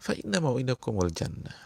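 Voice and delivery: normal talking voice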